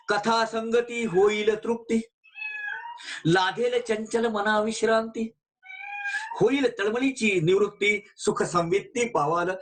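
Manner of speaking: धीमे, लय में पद का पाठ; ओवी को गाकर-सा, ठहरकर सुनाना; verse recitation. Real, not acted. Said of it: "recites Marathi verse". (पवित्र पद का धीमा पाठ)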